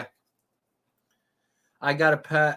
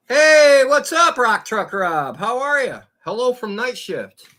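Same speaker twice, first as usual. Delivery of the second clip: high, loud, happy hello to a viewer; loud excited greeting; 11 semitones higher, 8 dB louder, slower than usual. Real, not acted. Streamer greets a viewer named Rock Truck Rob loudly, excited hello. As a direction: shout a big welcome across the room.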